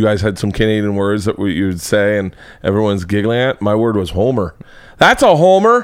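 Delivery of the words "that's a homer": higher-pitched mock sports announcer; imitated highlight-show home run call; sportscaster impression. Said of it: "you watch SportsCenter and they're like".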